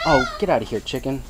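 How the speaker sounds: usual speaking voice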